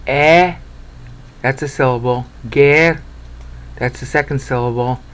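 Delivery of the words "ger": ordinary talk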